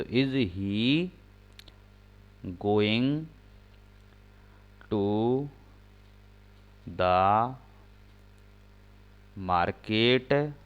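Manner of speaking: slow, each word set apart by pauses; slow dictation pace; dictating word by word. Shown as a demonstration. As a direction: spell out the sentence for writing. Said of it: "सहायक क्रिया बाहर हो जाएगी".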